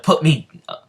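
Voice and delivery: high, loud acted panic; scared protest. Noel is carried into the air, scared protest. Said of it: "she's pretty scared".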